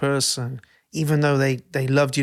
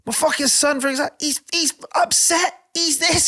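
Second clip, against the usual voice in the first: mock rant, much higher than usual; ranting sideline parent; 12 semitones higher than usual. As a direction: play the outraged dad telling off the coach, high-pitched. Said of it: "bollocking, um, somebody and going".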